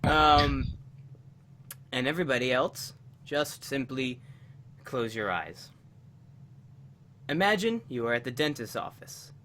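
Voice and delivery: soft low meditation voice; guided meditation. Acted. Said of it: "usual tradition of a guided meditation".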